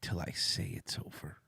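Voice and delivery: low menacing line sinking to a whisper. Acted.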